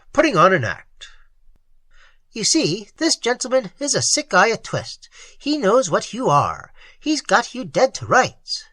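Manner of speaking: diagnose him coolly, like a case; clinical psychiatrist. Acted.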